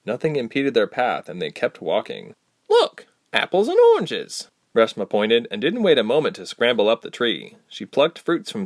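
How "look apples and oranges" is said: high, eager cry of discovery; a girl spotting fruit, calling out excitedly. Acted. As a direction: point and call out in delight. Reshma spots fruit trees.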